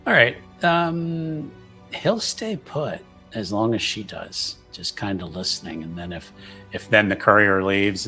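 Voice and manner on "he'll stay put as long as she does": ordinary talk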